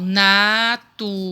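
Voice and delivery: NATUREZA slowly divided, na-tu-re-za; syllable by syllable. Shown as a demonstration. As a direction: stretch out each syllable of "natureza". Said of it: "vai separar e colocar o total de sílabas". ("natureza" devagar, separando as sílabas)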